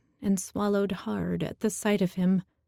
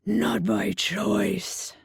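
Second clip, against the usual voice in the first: Minotaur outburst; much slower than usual. A narrator's reading. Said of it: "he screamed".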